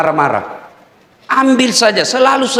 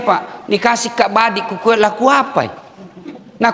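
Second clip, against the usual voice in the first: ordinary talk, nothing marked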